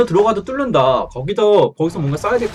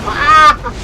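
sharp high scream; 9 semitones higher, 6 dB louder than usual. Real, not acted. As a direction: let out a short scream. Scream when caught.

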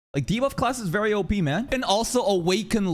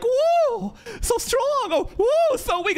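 mock cheering; much slower than usual. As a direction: play up fake excitement. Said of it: "any kind of comment like".

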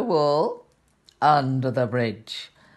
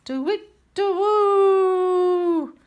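high, slow hooting owl; 3 dB louder, much slower than usual. Acted. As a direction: draw out the owl call. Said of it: "what can they hear".

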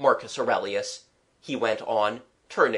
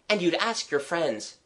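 put-on higher, keen child's lilt; gathering enthusiasm, lightly childlike; warming to the subject; 6 semitones higher than usual. Child planning who may live in the city, keen. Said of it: "warming to my subject".

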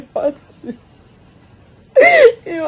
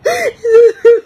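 high sob breaking out; 6 semitones higher, 4 dB louder than usual. Real, not acted. Grieving mother bursts out, sobbing high.

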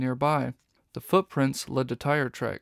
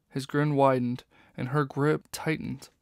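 ordinary delivery

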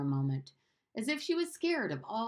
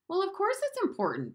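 high, irritated "of course"; irritable; 14 semitones higher than usual. Acted. Bruno irritably contradicts Maria, pitched high. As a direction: snap it back impatiently. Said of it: "said Bruno irritably".

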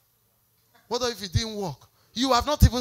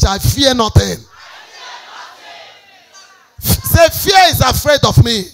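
louder, driven declaration; preaching declaration; 2 semitones higher, 5 dB louder than usual. Real, not acted. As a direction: lift the room with it. Declarations rise again.

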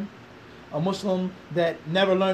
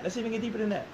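same line dropped to a softer voice; softer repeat; 2 semitones higher, 5 dB quieter, faster than usual. Real, not acted. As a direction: pull back and say it softly. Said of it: "repeated line, second take softer".

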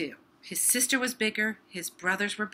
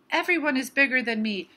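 a touch of grumbling, higher and louder; mild grumble; 4 semitones higher, 6 dB louder than usual. A narrator's reading. Little Hippo complains to himself about being smallest, mild grumble. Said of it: "grumbled Little Hippo to himself".

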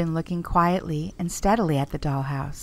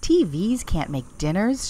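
ordinary delivery, nothing marked

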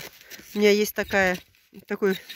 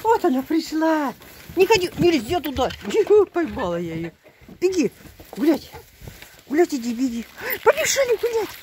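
high-pitched coaxing to a pet; high excited coaxing; 6 semitones higher, 7 dB louder than usual. Real, not acted. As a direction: cheer her on to run. Coaxing goat outside.

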